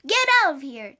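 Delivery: loud, forceful order; yelling. A narrator's reading. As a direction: yell it to drive the creature away. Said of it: "Jack yelled at it".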